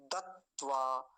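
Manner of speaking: दत्वा as dat-tvaa, held on the first t; split double t. Shown as a demonstration. Teacher showing how the word is built, split double t. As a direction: pause on the t in दत्वा, then tvaa. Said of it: "अब ये ऐसे बना".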